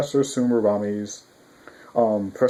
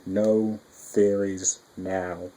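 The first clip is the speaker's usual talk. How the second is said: very slow drawn-out refusal; 3 semitones lower, much slower than usual. Real, not acted. Vlogger refusing to theorize, very slow and drawn out. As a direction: stretch it out slowly, closing the topic.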